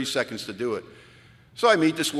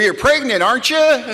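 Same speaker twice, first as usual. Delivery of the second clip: pitched way up, light and eager; a bright, much higher chirpy guess; high mock eager guess; 12 semitones higher, 9 dB louder than usual. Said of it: "like, 'Well".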